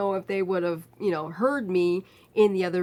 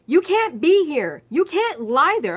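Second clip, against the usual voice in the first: raised stern scolding voice, higher and louder; stern nurse; 6 semitones higher, 7 dB louder than usual. Retold or imitated. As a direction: order him off the bed sharply. Nurse scolding patient off bed.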